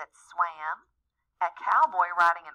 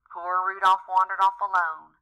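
plain reading voice

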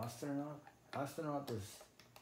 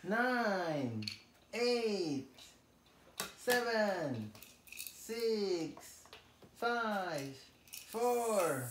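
high, ringing countdown calls; launch countdown; 7 semitones higher, much slower than usual. Pretend. Parent counting down a toy rocket launch, high announcer calls. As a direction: call each number out high, with a pause after it.

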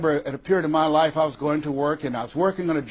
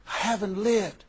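softer, hushed repeat of "I haven't lived"; soft echo; 4 semitones higher, 7 dB quieter than usual. Retold or imitated. Friend repeating her regret to herself, softer. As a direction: let "I haven't lived" fall away quietly.

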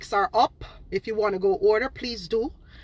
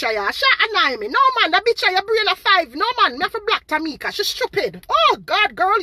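high, loud dismissive insults; mocking tell-off; 8 semitones higher, 4 dB louder than usual. Real, not acted. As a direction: brush her off with contempt. Telling off a troll commenter.

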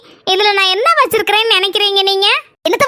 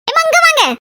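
calling out; 6 semitones higher, 6 dB louder, slower than usual. Acted. Calling the nurse over.

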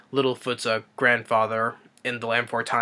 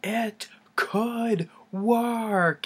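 very high, drawn-out cry of discovery; Gene Wilder's mad scientist; 11 semitones higher, much slower than usual. Retold or imitated. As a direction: stretch it out slowly like a manic genius. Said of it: "Gene Wilder was like".

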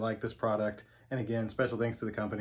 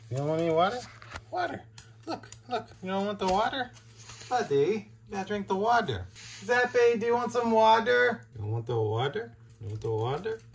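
pet talk; 11 semitones higher, 5 dB louder than usual. Real, not acted. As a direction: sing it up high to tempt the dogs. Coaxing dogs to drink.

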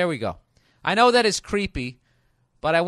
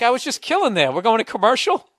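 louder, higher slick host sign-off; talk show host; 7 semitones higher, 6 dB louder than usual. Pretend. Comedian mocking a talk show host cutting a guest off. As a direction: wrap it up like a cheesy TV host. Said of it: "like when you do a panel on a talk show".

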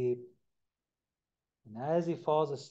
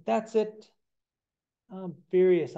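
louder, higher angry outburst; mad; 6 semitones higher, 7 dB louder than usual. A narrator's reading. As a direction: blow up in fury. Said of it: "he gets so mad".

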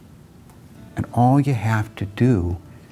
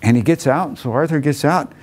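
ordinary delivery

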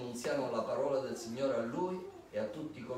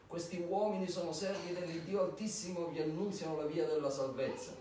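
raised-pitch proclamation; mild narrated cry; 6 semitones higher than usual. A narrator's reading. Slave girl crying out after Paul and his companions, mild higher-pitched cry. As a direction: call it out higher, without much added volume. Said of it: "gridava dicendo".

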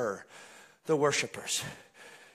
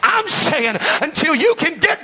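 high, loud revival sermon building to a cry; full-force preaching; 7 semitones higher, 9 dB louder than usual. Real, not acted.

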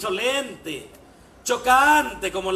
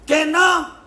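higher and louder, outraged; a furious nun's challenge; indignant shout; 7 semitones higher, 8 dB louder than usual. Acted. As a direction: snap it back at the drunk. Nun and drunk argue.

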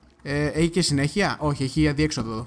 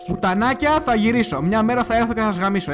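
raised, higher-pitched threat; vengeful threat; 5 semitones higher, 4 dB louder than usual. Real, not acted. Player to the spiders that annoy him, vengeful threat. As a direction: swear revenge at enemies you are fleeing.

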